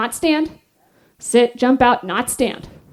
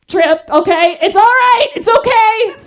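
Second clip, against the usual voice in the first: flustered; 8 semitones higher, 4 dB louder than usual. Retold or imitated. Tripping while lifting the weight.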